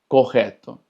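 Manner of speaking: Correcto said "ko-HEH-to", the rr as a throaty h; Portuguese double R. Shown as a demonstration. Teacher modelling Portuguese correto, throaty h. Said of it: "se va a escuchar como una J".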